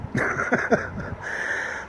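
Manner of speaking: low-volume, unvoiced chuckle; soft laugh. Real, not acted. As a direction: chuckle softly at your own remark. Laughs at his own swearing.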